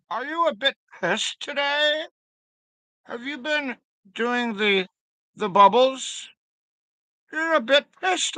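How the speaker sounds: very high, teasing British voice; British accent. Pretend. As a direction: tease someone in a high-pitched mock British accent. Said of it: "your bloody best British accent".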